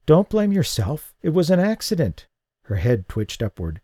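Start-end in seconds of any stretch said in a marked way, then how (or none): 0.0-2.3 injured woman comforting. A narrator's reading. She comforts him.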